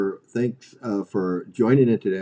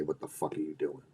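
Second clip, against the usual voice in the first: quiet blunt question; 8 semitones lower, 14 dB quieter than usual. Retold or imitated. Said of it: "I needed someone to say".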